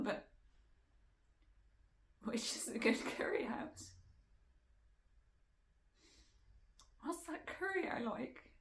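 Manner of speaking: high, strained voice close to tears; tearful pleading. Acted. Woman imagining calling the husband who hates her, tearful pleading.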